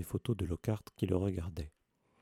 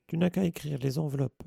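condescending favour; 6 semitones higher than usual. A narrator's reading. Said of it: "comme s'il lui faisait une exceptionnelle faveur".

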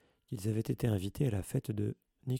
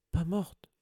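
a higher, choked Filch; choked gasp; 8 semitones higher than usual. A narrator's reading. Over his cat, Filch chokes out in shock. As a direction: strangle the words in disbelief. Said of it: "s'étrangla Rusard".

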